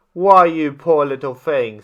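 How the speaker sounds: ordinary delivery, nothing marked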